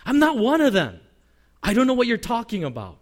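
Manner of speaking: emphatic, defensive mock denial; acted denial. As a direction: imitate a man insisting on mistaken identity. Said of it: "Peter claims mistaken identity".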